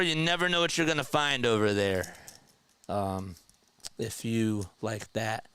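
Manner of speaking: normal talking voice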